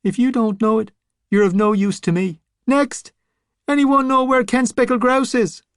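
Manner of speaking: calling out to the crowd for the next one; impatient zombie boss. Acted. Scapegrace dismisses, calls next.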